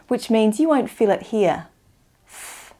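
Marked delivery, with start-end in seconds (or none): 2.3-2.7 th as a voiceless puff of air; tongue between teeth, blow Th without voice; unvoiced th. Shown as a demonstration. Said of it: "There's no vibration".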